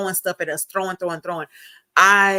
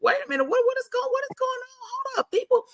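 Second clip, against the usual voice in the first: alarmed disbelief; faster than usual. Retold or imitated. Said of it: "we were like".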